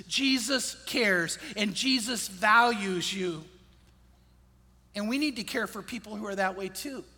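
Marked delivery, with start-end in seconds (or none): 0.1-3.4 each word slowed and leaned on; slow, weighty reassurance; slow emphatic. Acted. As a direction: let it land, slowly and firmly. Slow emphatic sermon climax.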